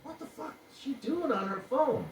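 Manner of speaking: baffled inner thought, up high; high incredulous voice. Retold or imitated. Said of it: "I'm like".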